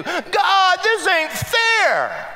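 high, drawn-out whine at God; mock a sulking child protesting; whining complaint. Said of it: "And what do we do?".

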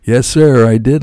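a hint of a cheerful woman; lightly voiced Lisa. A narrator's reading. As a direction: answer him brightly and politely. Voicing Lisa's upbeat reply.

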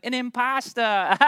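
high, drawn-out joke payoff; hammy punchline. Retold or imitated. Kid landing the joke for a talent scout, hammy punchline.

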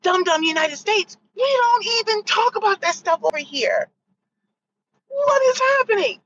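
exasperated disbelief. Retold or imitated. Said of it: "I'm like".

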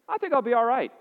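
high, loud, carefree brush-off; mock-casual shrug of a patient ignoring his cancer; breezy dismissal. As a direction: wave off the doctor like it's nothing.

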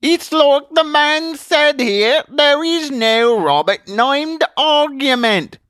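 high, loud, slow put-on voice; indignant audience member. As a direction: insist to the girl with exaggerated vowels. Someone backs up the magician.

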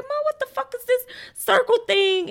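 high, hammed-up baffled son; mock frustrated son. Son calling his mom, high mock frustration. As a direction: play the son lost at a roundabout, way up high. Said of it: "He was like".

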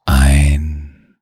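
slow, drawn-out relaxation voice with long pauses; guided meditation. Acted. Narrator settling a child in bed to rest, guided meditation. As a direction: lull the listener, stretching the key words.

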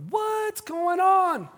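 high, loud, drawn-out cry of disbelief; mock bewilderment. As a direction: stretch it out, utterly baffled by something weird. Imagines kids receiving this strange healing.

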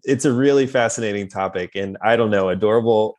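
usual speaking voice

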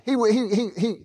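normal talking voice

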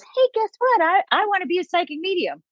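imitating an eager little kid; mock kid announcing. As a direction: play a child blurting out a dream job. Imagined child announcing career dream.

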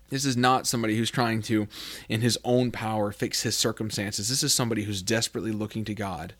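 ordinary talk, nothing marked

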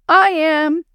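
high, loud eager shout; kids shouting. A narrator's reading. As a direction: call it out like keen children. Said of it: "the kids shouted together".

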